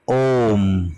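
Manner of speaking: ओम with the o held long; drawn-out om. Shown as a demonstration. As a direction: stretch the o of ओम slowly. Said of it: "ऐसे लिख देते हैं". (ओम को लंबा खींचकर)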